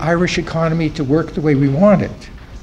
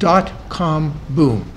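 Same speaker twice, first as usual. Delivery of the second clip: "Dot-com boom" drawn out word by word; "Dot-com boom" spaced out for a listener who missed it; slow clarifying repeat; much slower than usual. Real, not acted. Repeats after listener asks "The what?.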